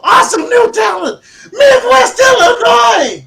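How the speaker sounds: high, very loud announcer scream; all-caps shouting. Shown as a demonstration. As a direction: scream it out like capital letters. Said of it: "when it's in caps".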